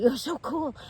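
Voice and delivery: high, fawning bartender imitation; gushing admiration. Girl bartender to the tattooed customer, gushing in a high voice. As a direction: mimic someone instantly smitten, pitched well up.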